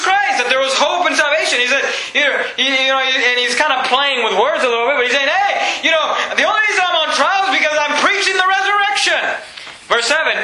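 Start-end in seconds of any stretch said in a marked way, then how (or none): 5.3-9.2 high, shouted protest; shouting. Retold or imitated. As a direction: shout your innocence to the room. Paul's defiant cry retold.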